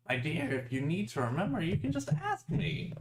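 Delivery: high, airy little voice; a tiny fairy on the shoulder, lilting; twinkling breathy fairy whisper. Acted.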